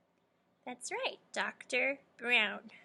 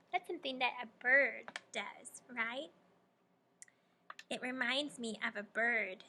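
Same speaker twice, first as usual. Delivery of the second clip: ordinary delivery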